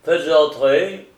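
loud, drawn-out order to enter; commanding order. A narrator's reading. Attorney general has a visitor shown in, commanding. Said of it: "ordonna William Hobbes".

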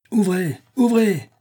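high, loud shouted command; forceful high cry, falling at the end; soldiers shouting. A narrator's reading. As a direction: yell it at a closed door. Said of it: "crièrent les soldats".

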